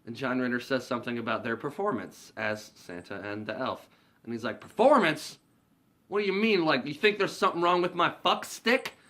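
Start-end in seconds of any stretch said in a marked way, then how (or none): 4.6-8.9 high, loud indignant outburst; offended yell. Retold or imitated. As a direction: bristle at the insult, much higher. Drunk Willie takes offense.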